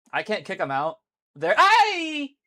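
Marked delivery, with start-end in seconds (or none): 1.6-2.4 a sudden high, loud yelp; startled yelp. Real, not acted. Streamer yelps at the shrooms popping up, loud and high. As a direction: squeal in surprise mid-sentence.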